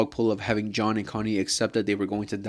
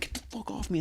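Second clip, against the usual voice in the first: harsh whisper, pitched up and much softer; whispered snap; 10 semitones higher, 10 dB quieter, much faster than usual. Retold or imitated. Vlogger imitating Gabi snapping at the mom, harsh high whisper. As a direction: spit it out under the breath. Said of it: "And she goes, like".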